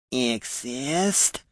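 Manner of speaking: soft, hissing reptile voice; lizard man. A narrator's reading. Lizard man among the unaware heroes, hissing. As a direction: hiss it like a lizard creature. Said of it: "said the lizard man".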